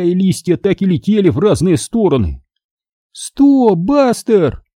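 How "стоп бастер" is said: put-on very high girl's yell; shrieked command. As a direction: yell high to stop the dog. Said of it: "завопила Минди". (высокий вопль девочки)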